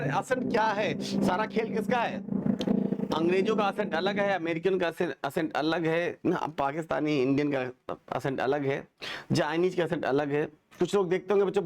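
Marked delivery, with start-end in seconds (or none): none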